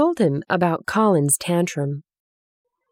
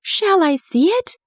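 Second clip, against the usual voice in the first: high, eager question with mild emotion; overwhelmed plea; 13 semitones higher than usual. A narrator's reading. Colin begs to see the secret garden, overwhelmed plea. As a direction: plead high, as if nearly overcome. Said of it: "nearly sobbing".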